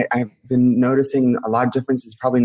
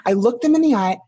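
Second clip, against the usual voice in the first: scared, overwrought confession; 12 semitones higher than usual. Acted. Guest reliving the family being shrunk, distressed and scared. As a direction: plead your childhood hurt, pitched well up.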